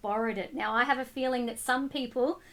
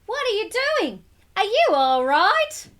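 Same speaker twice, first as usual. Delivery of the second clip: worried calling; 8 semitones higher, 5 dB louder than usual. Acted. Boy calling anxiously into the pool, higher worried call. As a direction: call out fearing the worst.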